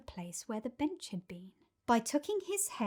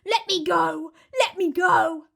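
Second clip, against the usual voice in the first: yelled; 7 semitones higher, 10 dB louder than usual. Acted. Crocodile dangling upside down yells at Trunky. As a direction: yell it while dangling upside down. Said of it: "yelled the enormous crocodile".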